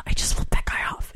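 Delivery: unvoiced confession whispered aside. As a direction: whisper it so nobody hears.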